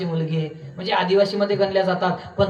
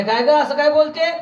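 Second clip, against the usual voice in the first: mild scolding father-in-law; 6 semitones higher than usual. Retold or imitated. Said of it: "सासरा म्हणे".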